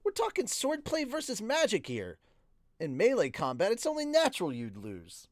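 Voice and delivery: high, emphatic character voice; Eris, insistent. A narrator's reading. Swordswoman explaining a sparring result, insistent. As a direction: argue the point, pitched well up. Eris's dialogue in story.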